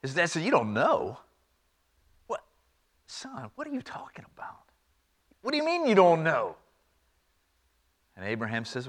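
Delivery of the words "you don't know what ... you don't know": disbelieving father. Acted. Father questioning son's plan.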